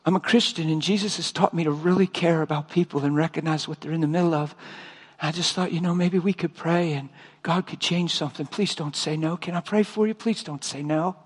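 soft, earnest retold plea; hushed, pleading with a stranger; near-tearful plea. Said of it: "I just started to cry".

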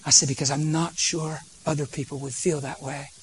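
calm the angry father down tenderly; gentle plea. Retold or imitated. Preacher pleads softly with the stern dad on the plane.

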